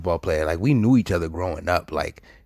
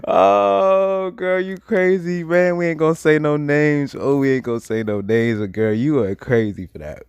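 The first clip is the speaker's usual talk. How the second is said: amused exclaiming; 9 semitones higher, 5 dB louder than usual. Real, not acted. Storyteller calling out his old girlfriend as crazy, amused wail. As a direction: wail it in amused disbelief, gradually settling. Teasing the girl after laughing fit.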